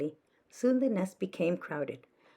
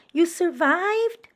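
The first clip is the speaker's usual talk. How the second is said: high, slow, loud amazed question; crying out in joy; 12 semitones higher, 9 dB louder, slower than usual. Acted. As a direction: draw it out in happy disbelief. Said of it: "cried Stella Luna".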